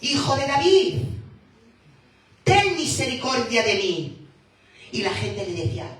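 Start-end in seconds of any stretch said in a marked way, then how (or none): 0.0-4.3 loud, high desperate cry for mercy. Acted. Blind beggar shouting to Jesus over the crowd, desperate loud plea. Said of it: "empezó a gritar".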